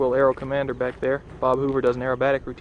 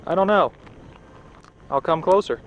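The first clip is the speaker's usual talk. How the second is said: calling out; 5 semitones higher, 8 dB louder than usual. Real, not acted. Calling across to other speaker.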